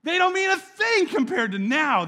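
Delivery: Paul bursting with joy; a joyous shout of testimony; fervent Paul. Retold or imitated. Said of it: "Paul's testimony was".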